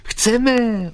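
high, loud shouted answer; eager high-pitched yell; eager joint reply. Acted. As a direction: answer as two boys calling out together. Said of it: "odpověděli jedním hlasem".

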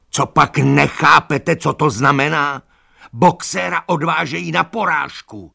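high, loud, anguished yell; donkey crying out that Boxer goes to slaughter; despairing shout. A narrator's reading. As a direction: hammer the terrible truth home. Benjamin explains in despair.

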